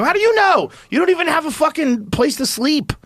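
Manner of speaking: angry outburst. Retold or imitated. Refusing to drive Water Dog, yelling in exasperation. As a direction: blow up at him in disbelief.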